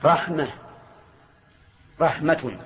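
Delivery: normal talking voice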